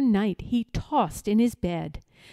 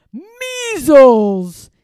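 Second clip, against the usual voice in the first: loud, higher villain's shout; 4 semitones higher, 12 dB louder than usual. Acted. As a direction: bellow it as an alarm. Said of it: "he shouted".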